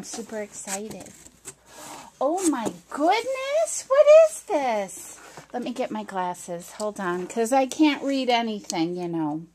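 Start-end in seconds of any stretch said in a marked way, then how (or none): 2.2-5.2 surprised. Real, not acted. Opening a surprise package.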